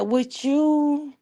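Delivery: high, teasing question; sly comeback. Retold or imitated. Niece teasing her proper aunt, high and sly. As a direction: tease the aunt back. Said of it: "I said".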